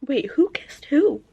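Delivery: a slow, stunned whisper; shocked whisper. Real, not acted. Viewer whispers "who kissed who" in shock at the book. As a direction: whisper it in disbelief.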